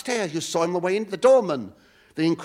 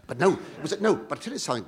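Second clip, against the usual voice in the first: ordinary delivery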